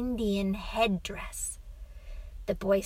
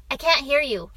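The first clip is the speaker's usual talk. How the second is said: put-on higher, loud child voice; complaining boy; 5 semitones higher, 7 dB louder, faster than usual. A narrator's reading. Boy can't hear whispering father, complaining. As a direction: call for more volume as a boy.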